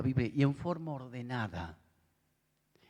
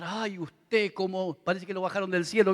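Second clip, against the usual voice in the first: mock admiring; 7 semitones higher than usual. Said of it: "no tenés que admirar".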